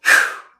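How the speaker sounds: short, forceful burst of breath; loud exertion exhale; sharp punch exhale. Real, not acted. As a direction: blow out hard with the punch. Punch exhale during counted reps.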